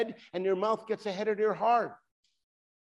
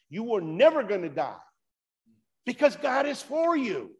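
higher, louder preaching, slowing on the cross; fervent preaching; 6 semitones higher, 3 dB louder than usual. Acted. Preacher telling the congregation God is for them, fervent and emphatic. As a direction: proclaim it to the whole church. Preacher builds to full force.

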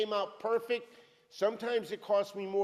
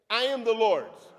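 loud, higher ringing declaration; loud call-and-response; 4 semitones higher, 10 dB louder than usual. Shown as a demonstration. Preacher leading the congregation in a loud affirmation. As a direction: shout it out for all to join. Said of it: "Say that with me".

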